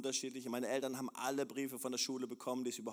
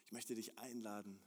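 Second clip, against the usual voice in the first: ordinary talk, nothing marked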